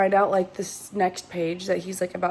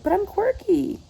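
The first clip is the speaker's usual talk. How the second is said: high, cutesy self-satisfied squeal; mock quirky girl; 11 semitones higher, 3 dB louder than usual. Reader mocking the heroine: high cutesy quirky voice. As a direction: pitch it up like a quirky girl fishing for praise. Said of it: "That's what she's giving".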